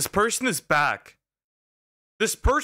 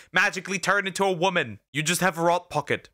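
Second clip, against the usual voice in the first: usual speaking voice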